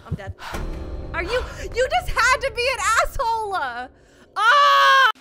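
loud high panicked yelling; terrified screaming. Real, not acted. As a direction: scream in real fright. Ghost kills player.

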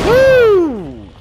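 loud, very high whoop; cheer. Real, not acted. Cheering his teammate's kill with a loud whoop. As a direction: celebrate the kill at the top of the voice.

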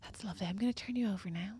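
soft, hushed spa therapist voice; masseuse voice. Pretend. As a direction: play a gentle masseuse. Role-playing masseuse.

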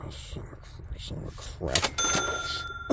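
slow breathy whisper of a swallowed curse; real frustrated whisper. Real, not acted. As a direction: mutter it under your breath, very slowly. Muttered curse at bad pull.

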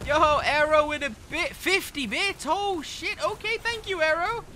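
high-pitched happy surprise; excited thanks. Real, not acted. Streamer thanking a viewer for fifty bits, excited. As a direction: gush in surprise, voice jumping up high.

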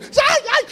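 very high mock yelps; yelping in pain. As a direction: yelp as if a dog just bit you. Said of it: "and bites him".

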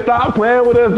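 loud, high excited yell; too loud. Real, not acted. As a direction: still shouting in triumph. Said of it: "too loud, man".

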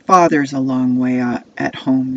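loud taunt, easing off; sneering. Acted. Brothers mocking the boy far from father, sneering. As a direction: sneer it at him, starting loud. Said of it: "they sneer".

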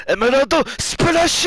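high, loud battle cry; anime attack shout. Retold or imitated. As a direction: yell the attack name. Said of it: "he's just like".

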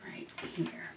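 soft unvoiced whisper. Real, not acted. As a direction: murmur it as a secret plan. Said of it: "whispering to herself".

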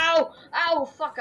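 pained yell. Real, not acted. Killed in the game.

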